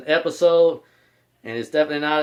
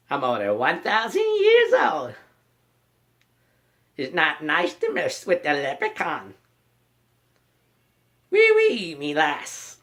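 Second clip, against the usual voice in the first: Warwick Davis leprechaun. Retold or imitated. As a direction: play the impish leprechaun teasing. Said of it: "I got a couple more from the movie I like".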